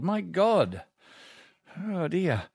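usual speaking voice

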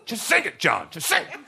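high coaxing voice; urging friend. Retold or imitated. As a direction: egg him on to sing. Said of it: "he always said".

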